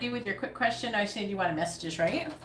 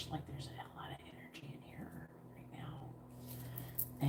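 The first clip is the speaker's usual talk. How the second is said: breathy hushed whisper, much softer; 5 semitones lower, 17 dB quieter, slower than usual. Real, not acted. Reader whispering about the heavy energy in the room. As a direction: drop to a whisper, spooked by the room.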